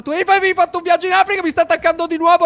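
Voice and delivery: high, loud and fast panic; panicked. Acted. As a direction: yelp it as the leopard bites. Attaccata dal leopardo. (panico acuto e veloce, urlato)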